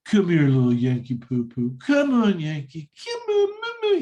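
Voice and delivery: put-on high cooing baby talk to a cat. Family coaxing cat under the shed, high baby talk. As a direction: coax the cat like a pet. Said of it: "they all start going".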